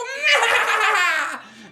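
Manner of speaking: loud, high cackle; evil witch cackle. A narrator's reading. Witch gloating at caged Hansel, evil cackle. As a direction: cackle over your prey.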